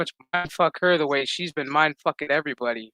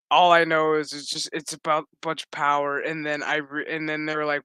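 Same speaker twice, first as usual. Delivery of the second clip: normal talking voice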